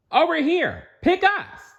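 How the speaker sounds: high, loud eager call; crying out. Acted. SpongeBob and Patrick begging the king to pick them, loud eager shout. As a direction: shout to be picked, waving wildly. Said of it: "they cried".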